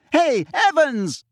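high, loud, drawn-out call; shouting after someone. A narrator's reading. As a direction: yell to someone walking off. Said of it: "James shouted after her".

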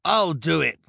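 loud, high shout; eager henchman's cry; volunteering cry. A narrator's reading. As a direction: call out to claim the job. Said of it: "cried the hook-handed man".

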